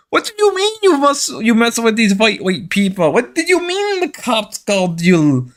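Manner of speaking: high-pitched, hammed-up Mexican-accented scolding; mock Mexican scolding. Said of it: "I gotta stop doing the Mexican voice".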